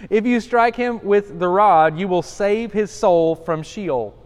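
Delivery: slow, emphatic verse reading; solemn pulpit reading; scripture reading. Acted. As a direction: lean on each word of the verse. Reads scripture aloud.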